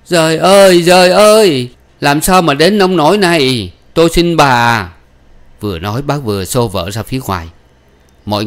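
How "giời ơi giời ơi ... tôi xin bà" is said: high, loud wail of despair; breathless pleading. Acted. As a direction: beg desperately as if out of breath. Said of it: "Bác nói không ra hơi".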